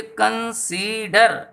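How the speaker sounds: Consider slowly broken into three, middle syllable held; syllable-by-syllable demo. Shown as a demonstration. Teacher counting syllables for pupils, consider slow and split up. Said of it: "यहाँ तीन syllables हैं".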